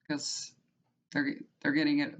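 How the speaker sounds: usual speaking voice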